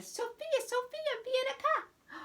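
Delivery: high-pitched Italian grandmother calling; very high, sing-out summons; Nana calling. Acted. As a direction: call the child over in a high Nana voice. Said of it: "I hear Nana".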